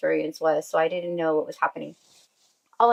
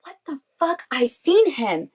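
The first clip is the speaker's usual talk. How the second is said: high-pitched mock scream, held back in volume; high, strained retold exclamation; 7 semitones higher than usual. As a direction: relive a stunned cry without shouting. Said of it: "I screamed and I said".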